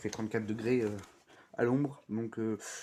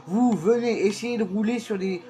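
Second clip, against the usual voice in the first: loud, higher-pitched heated defence; animated rant; 7 semitones higher, 4 dB louder than usual. Real, not acted. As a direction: argue your case, fired up. Defensive rant about driving.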